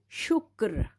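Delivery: normal talking voice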